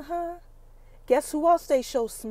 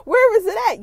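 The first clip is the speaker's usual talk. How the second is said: higher and louder, demanding; demanding shout; 8 semitones higher, 11 dB louder, faster than usual. Retold or imitated. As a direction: bark the question at her. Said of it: "He's like".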